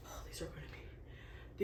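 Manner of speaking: excited whisper. Real, not acted. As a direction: breathe it in anticipation. Whispered anticipation.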